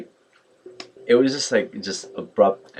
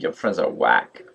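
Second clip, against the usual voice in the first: lower-pitched mock disdain; bitchy, nasty; 4 semitones lower than usual. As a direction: imitate a snide put-down. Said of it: "cunty, nasty, like, vibe and attitude".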